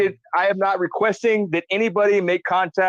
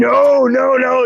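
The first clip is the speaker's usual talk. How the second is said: higher, louder, laughing denial; loud protest; 9 semitones higher, 8 dB louder, much slower than usual. Real, not acted. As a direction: shut down the joke loudly. Protesting a joke.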